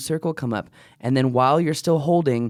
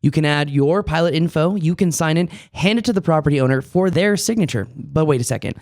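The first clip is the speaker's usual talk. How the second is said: upbeat, louder sponsor pitch; ad read; 3 semitones higher, 4 dB louder than usual. Acted. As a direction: sell it like a commercial.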